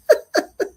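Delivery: high chuckle; real laugh. Real, not acted. Laughs after favorite-person remark.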